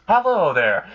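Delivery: bright, high and loud hello; cheerful greeting. Acted. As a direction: pop in with a showman's hello. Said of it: "Alistair just pops into existence".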